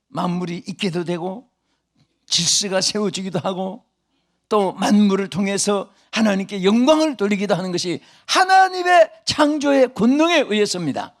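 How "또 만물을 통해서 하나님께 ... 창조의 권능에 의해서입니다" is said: loud, high, rising preaching cry; full-force preaching. Acted. Revival preacher crying out to his congregation, loud and high. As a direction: thunder the power of God to the hall.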